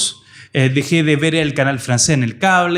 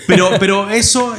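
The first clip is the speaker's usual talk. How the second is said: talking through real laughter, higher pitch; laughing; 5 semitones higher than usual. Real, not acted. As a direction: carry on while still amused and laughing. Guest laughs at host's joke. (hablando entre risas)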